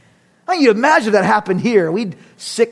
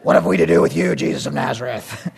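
low demon voice; demon impersonation; 9 semitones lower, faster than usual. Acted. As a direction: speak as a low-pitched demon. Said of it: "that's my best demon impersonation".

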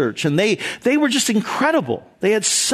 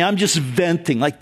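ordinary talk, nothing marked

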